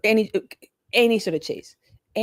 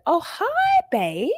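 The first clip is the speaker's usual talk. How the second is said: swooping much higher on oh hi, then dropping; mock two-faced sweetness; fake sweet greeting; 10 semitones higher, much slower than usual. Mimicking a gossiper greeting her target, fake sweet. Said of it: "they're like".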